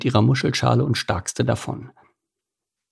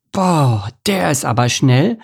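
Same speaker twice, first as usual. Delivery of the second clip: amazed; 5 semitones higher than usual. Acted. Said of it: "staunte Lars".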